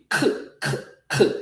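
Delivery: "K" as a bare voiceless consonant puff; French Q sound. Shown as a demonstration. Teacher shows how Q is pronounced in French, bare k sound. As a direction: fire off a short, unvoiced "K" sound. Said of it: "how do we pronounce Q?".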